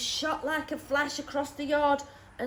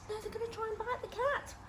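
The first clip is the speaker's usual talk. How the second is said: higher, softer alarm; mock worried; 10 semitones higher, 5 dB quieter, faster than usual. Reader fretting for the cat to the children, higher. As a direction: sound anxious for the cat.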